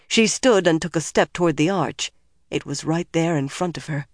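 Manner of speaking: plain reading voice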